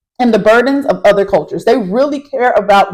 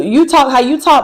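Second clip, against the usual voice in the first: normal talking voice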